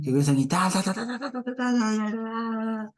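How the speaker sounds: high ta-ta-ta preacher ranting; mock loud preacher. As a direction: parody a preacher's rising rant. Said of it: "como es esos predicadores". (imitación de predicador gritón)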